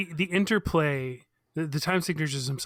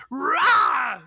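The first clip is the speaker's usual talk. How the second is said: a quick "rah!", much higher and louder; short held-back yell; 12 semitones higher, 6 dB louder than usual. Shown as a demonstration. Imitating the singer exploding, a brief restrained shout. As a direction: bark out a small explosive yell, holding back. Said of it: "I can't do it 'cause I don't wanna hurt my throat".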